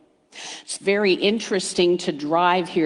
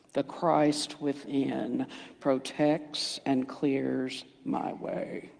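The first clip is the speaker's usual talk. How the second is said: slow, soft, half-hearted mumble; flat mumbled affirmation; 6 semitones lower, 9 dB quieter, much slower than usual. Shown as a demonstration. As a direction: say it like you don't believe it. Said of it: "if I said it like that".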